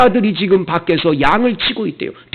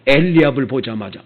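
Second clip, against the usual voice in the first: ordinary talk, nothing marked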